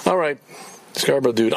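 normal talking voice

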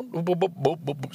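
mock bleep sounds to cover the swearing; self-censoring beeps. Real, not acted. Catching his own swearing, playful bleeping sounds. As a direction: bleep yourself out.

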